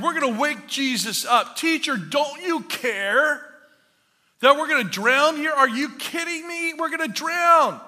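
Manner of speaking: high, loud panicked pleading; freaking out. Acted. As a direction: cry out in mounting alarm, acting the terrified disciples. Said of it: "they're freaking out".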